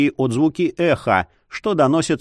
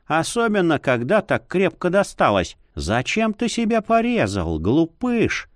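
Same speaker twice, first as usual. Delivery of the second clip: ordinary delivery, nothing marked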